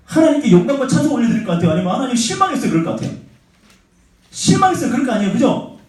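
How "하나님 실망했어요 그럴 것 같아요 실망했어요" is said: high-pitched, put-on disappointed complaint; mock disappointment. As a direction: act out a let-down believer, pitched up. Acting out a disappointed believer. (실망한 척 높은 목소리로 투정하듯)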